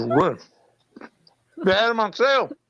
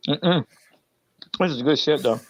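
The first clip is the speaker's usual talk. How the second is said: chewing while talking; mouth full; 4 semitones lower, much slower than usual. Real, not acted. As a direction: talk through a mouthful of ice cream. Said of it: "Joe can't talk with his mouth full".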